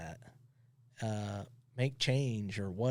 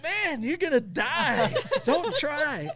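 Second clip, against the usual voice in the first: laughing; 13 semitones higher, 6 dB louder, slower than usual. Real, not acted. Laughing while quoting himself.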